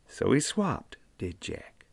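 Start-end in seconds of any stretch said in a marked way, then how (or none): none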